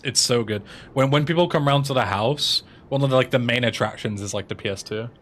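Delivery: ordinary delivery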